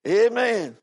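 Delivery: ordinary talk, nothing marked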